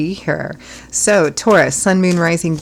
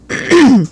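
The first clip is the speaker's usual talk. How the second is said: loud, quick throat clear; 10 semitones higher, 9 dB louder than usual. Real, not acted. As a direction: clear the frog from your throat. Hoarse host clears throat mid-sentence.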